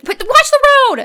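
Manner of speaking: high-pitched imitated passenger cry; mock alarmed yell. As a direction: cry out a frantic warning, playfully acted. Passenger yelling at driver, retold.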